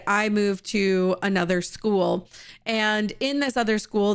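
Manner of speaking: ordinary talk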